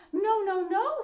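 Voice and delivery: little wolves. A narrator's reading. Little wolves refusing the pig, high and loud. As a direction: squeal no like small scared wolves. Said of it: "said the little wolves".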